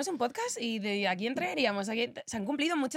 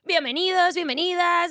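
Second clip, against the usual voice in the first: loud, high, bright-voiced welcome; opening the show, calling out a big welcome; hearty welcome; 6 semitones higher, 6 dB louder than usual. Real, not acted. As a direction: greet the audience with a big cheer. Show opening greeting to listeners.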